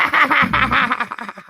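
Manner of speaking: real laugh, high and loud. Real, not acted. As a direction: crack up at your own punchline. Laughs at own punchline.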